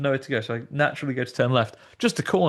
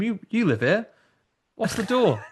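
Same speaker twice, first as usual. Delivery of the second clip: higher, pleading and polite; mock lost plea; 7 semitones higher than usual. [name] pleading with the scare actor in the padded cell, higher. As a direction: ask the actor for help, half-lost. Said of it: "had to go back to him and said".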